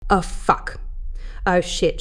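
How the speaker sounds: understated, spent moaning; soft moan. A narrator's reading. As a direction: moan it lightly, barely pushed. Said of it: "she moaned".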